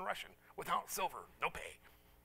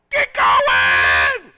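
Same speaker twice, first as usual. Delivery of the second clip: huge drawn-out mock bellow; imitated yelling; 11 semitones lower, 26 dB louder than usual. Old woman yelling at us on the bus, mock furious bellow. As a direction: holler it at full force, hammed up. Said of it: "She's yelling at us".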